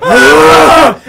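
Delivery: loud high strained yell; a winded soldier crying out; exhausted cry. Retold or imitated. Armored guard exhausted sprint.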